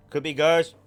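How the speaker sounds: ordinary talk, nothing marked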